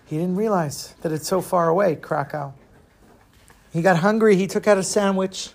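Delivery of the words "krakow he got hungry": usual speaking voice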